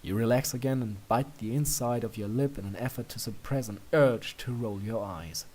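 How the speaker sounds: plain reading voice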